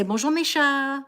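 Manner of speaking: high, drawn-out French hello; greeting the cats. Shown as a demonstration. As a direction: sing out a French greeting to pets. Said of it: "When I come home, I say".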